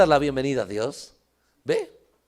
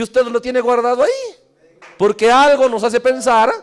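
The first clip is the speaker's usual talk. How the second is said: higher, louder preaching voice; raised preaching; 7 semitones higher, 6 dB louder than usual. Acted. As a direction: keep the sermon pressing forward.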